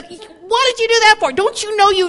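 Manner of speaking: high, loud mock blow-up; angry outburst. A speaker acting a person exploding in the hallway, loud outburst. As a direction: explode as if shoulders just bumped. Person dumps gunny sack.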